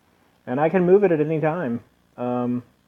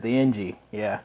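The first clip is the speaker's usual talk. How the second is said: ordinary talk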